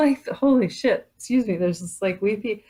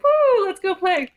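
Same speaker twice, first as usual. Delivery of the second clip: much higher and louder, bursting with glee; playful whoop; 12 semitones higher, 4 dB louder than usual. Retold or imitated. Playful energy voiced as a gleeful shout. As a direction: squeal it out like a kid let loose.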